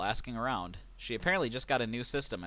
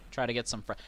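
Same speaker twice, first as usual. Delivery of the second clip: ordinary talk, nothing marked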